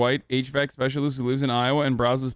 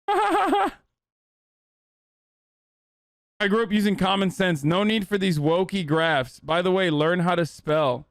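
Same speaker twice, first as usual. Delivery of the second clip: mock boomer; 3 semitones higher than usual. Mocking a boomer commenter.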